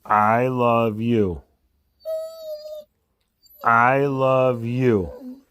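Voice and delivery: slow, stretched out love you; drawn out. Shown as a demonstration. Owner coaxing the dog to say it back, slow. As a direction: draw each word out slowly to coax. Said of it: "Give me a good one".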